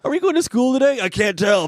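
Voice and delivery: put-on like a little kid asking. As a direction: pipe up like a schoolkid. Childish quip in a high voice.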